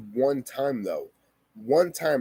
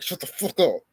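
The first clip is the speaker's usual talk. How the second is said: retold angry command; 3 semitones higher than usual. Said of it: "and he's like".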